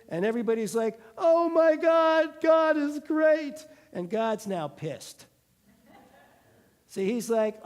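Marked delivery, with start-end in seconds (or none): 1.2-3.7 high, loud, slow hammed-up awe; mock awe. Israelites awed by the boom, hammed-up praise. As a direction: cry out in exaggerated wonder.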